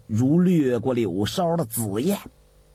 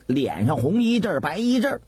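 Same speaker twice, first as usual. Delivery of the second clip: usual speaking voice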